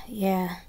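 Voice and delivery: whispered sigh then yeah; exasperated breathy sigh; weary sigh. Acted. Narrator reacting to the dim king, whispered sigh.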